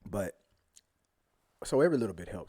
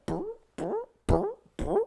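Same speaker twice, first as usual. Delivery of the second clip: lip-buzz hit sounds; playful punch sound effects; imitated punch noises; 3 dB louder than usual. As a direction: buzz out the impacts.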